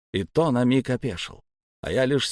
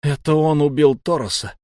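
plain reading voice

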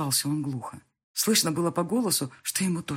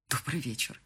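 softer, flat mock of his stiff greeting; quiet bitter imitation; mocking the officialness; 3 semitones lower, 7 dB quieter than usual. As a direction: echo his cold hello under your breath. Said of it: "Как официально".

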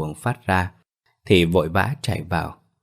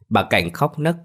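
usual speaking voice

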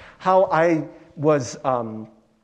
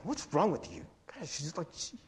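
muttering to yourself; 12 dB quieter than usual. Acted. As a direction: mutter it under your breath, annoyed. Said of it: "muttering to yourself".

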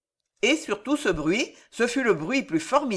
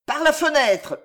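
high, raised cry; yelled order; 8 semitones higher, 4 dB louder than usual. A narrator's reading. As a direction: yell a command across the crowd. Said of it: "hurla Maurevert". (ordre hurlé)

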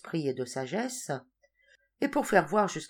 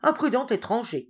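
sharp rising rebuke; angry king, higher and louder; shaking with anger; 7 semitones higher, 5 dB louder than usual. A narrator's reading. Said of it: "Il ne put retenir sa colère".

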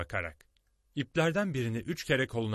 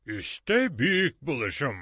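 proud, drawn-out, higher and louder announcement; boastful; 4 semitones higher, 5 dB louder, slower than usual. A narrator's reading. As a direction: present it slowly and grandly, showing off. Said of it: "dedi böbürlenerek".

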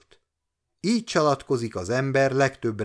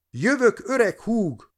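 high, loud call down the street; excited high hail; 10 semitones higher, 3 dB louder than usual. A narrator's reading. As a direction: shout it to the empty street. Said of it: "kiáltozott az elhagyott utcán".

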